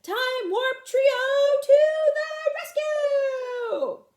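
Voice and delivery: high, slow sung-out battle cry; heroic call. Acted. Fred announces the rescue to the clearing, slow sung battle cry. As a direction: proclaim it like a superhero, drawn out.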